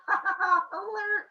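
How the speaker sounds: very high mock cackle; high comic villain laugh; goofy monster laugh. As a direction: cackle like a silly brute. Brother laughing, attacking.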